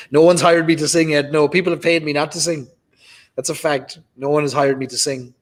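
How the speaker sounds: usual speaking voice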